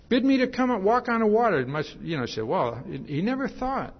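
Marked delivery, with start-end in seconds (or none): 0.1-1.6 eager call. Retold or imitated. Peter impulsively asking again.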